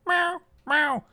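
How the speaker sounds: high-pitched imitation meows; meowing cat. Cat nagging its owner awake for food, imitated meowing. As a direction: mimic a cat's insistent, repeated meow. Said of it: "fucking meows over and over".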